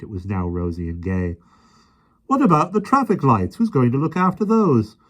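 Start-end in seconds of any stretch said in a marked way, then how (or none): 2.3-5.0 protesting. A narrator's reading. First policeman protesting.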